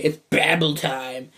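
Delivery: usual speaking voice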